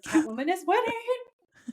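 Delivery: high, slow, gleeful cheer; excited cheer. Retold or imitated. Fan cheering Catwoman on while recapping, gleeful mock cheer. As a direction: crow it like a delighted fan.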